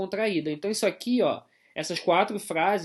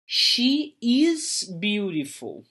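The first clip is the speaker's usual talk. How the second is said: slow, careful English example; teacher modelling slowly; slow model sentence; 2 semitones higher, much slower than usual. Shown as a demonstration. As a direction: spell it out slowly for the class. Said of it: "ela é bonita".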